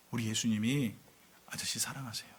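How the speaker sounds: low, hushed and tender; soft retold last words; gentle witness. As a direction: imitate a boy softly reassuring his executioner. Condemned boy gently witnesses to guard.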